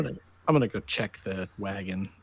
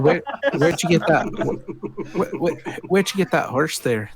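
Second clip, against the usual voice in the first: higher, louder, stuttering challenge; stammering accusation; 7 semitones higher, 6 dB louder than usual. Acted. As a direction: catch them out, tripping over words.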